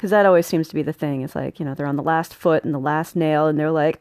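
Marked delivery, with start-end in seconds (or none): none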